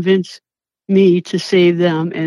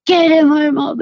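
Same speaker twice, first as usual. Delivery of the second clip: screaming at food; 6 semitones higher than usual. Retold or imitated. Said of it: "I'm screaming at the, the food".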